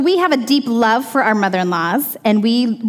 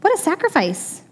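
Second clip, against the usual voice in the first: amazed; 7 semitones higher, 3 dB quieter, slower than usual. Retold or imitated. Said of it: "their hearts were even like".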